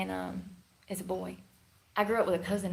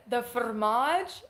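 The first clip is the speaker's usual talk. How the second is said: slow, puzzled The fromage?; imitating the cashier; much slower than usual. The cashier repeating her order back, slow and puzzled. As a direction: drag it out like a baffled cashier. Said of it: "She says".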